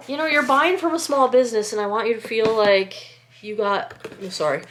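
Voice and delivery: ordinary talk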